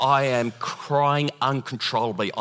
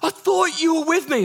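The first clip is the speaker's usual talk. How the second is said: high-pitched mock wail of complaint; panicked cry; 4 dB louder than usual. Frightened disciple protests to Jesus in the storm, panicked cry. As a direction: cry out in hammed-up alarm.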